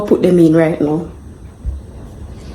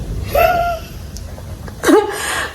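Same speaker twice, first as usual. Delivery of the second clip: laughing; 6 dB quieter than usual. Real, not acted. Embarrassed at burnt sugar.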